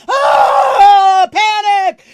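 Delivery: flailing high scream of panic; high, loud mock panic yell; panic shout. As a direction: shriek it, arms flailing.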